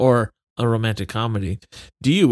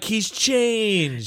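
put-on swooning girl; 9 semitones higher than usual. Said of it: "And she's like".

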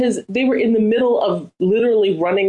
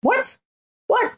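squeaky, much higher disbelieving what; pitched-up mock bafflement; baffled what; 12 semitones higher than usual. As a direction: play it as total confusion at the deal. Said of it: "I'm like".

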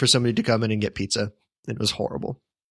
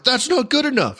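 mock indignant customer; 13 semitones higher than usual. Customer rejecting free pizza, high mock indignation. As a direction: whine the refusal in a high indignant voice.

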